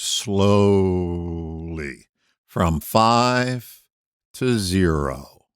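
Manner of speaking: slow, stretched words with pauses between; drawn-out slowly. Shown as a demonstration. Hypnotherapist showing how to count backwards, drawn-out slowly. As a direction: draw each word right out. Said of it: "by slowly, I mean slower".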